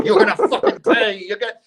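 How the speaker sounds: mock mobster threat. The debt collector mocking movie mobsters, hammed-up gangster threat. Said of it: "thirty-eight snub nose in the eye".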